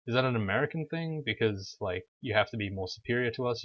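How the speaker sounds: normal talking voice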